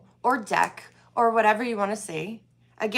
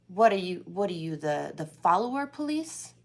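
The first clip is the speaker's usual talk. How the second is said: ordinary talk, nothing marked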